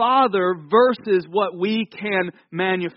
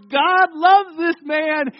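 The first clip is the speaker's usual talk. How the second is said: high, slow crowd proclamation; villagers' acclaim; 9 semitones higher, 4 dB louder, slower than usual. Acted. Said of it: "they're going".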